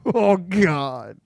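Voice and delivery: usual speaking voice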